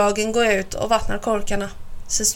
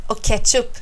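ordinary delivery, nothing marked

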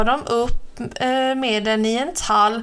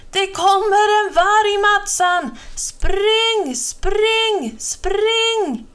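high, loud terrified calling; boy screaming a warning at his hiding friend, panicked; panicked shout; 10 semitones higher, 4 dB louder, much slower than usual. Acted. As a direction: yell for your friend to run.

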